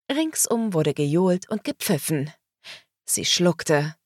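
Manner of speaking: ordinary delivery, nothing marked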